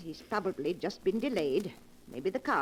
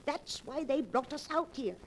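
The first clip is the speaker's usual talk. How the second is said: dawning fear; 7 semitones higher than usual. Acted. Martha realizing the murder plot.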